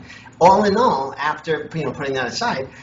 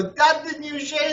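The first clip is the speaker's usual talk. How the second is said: grandmother voice; 13 semitones higher than usual. Retold or imitated. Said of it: "she'd come home and go".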